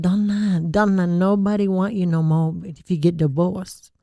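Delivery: put-on slow, pleading-style Thai-accented mother; Thai mom impression. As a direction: imitate a Thai mom's heavy accent, slowly. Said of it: "this impression of my mom".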